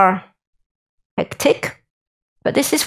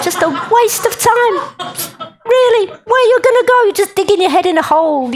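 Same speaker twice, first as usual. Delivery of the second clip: much higher, laughing disbelief; incredulous; 9 semitones higher than usual. Real, not acted. Mocking theology with high laughing disbelief. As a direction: scoff at it, pitch way up.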